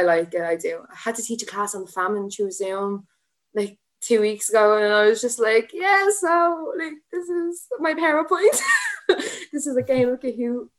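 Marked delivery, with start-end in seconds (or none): 5.7-10.7 high, giggly mock teacher voice; a flustered new teacher laughing at herself; awkward laughing. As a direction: act out nervously presenting, laughing through it. Awkward Zoom teaching retold.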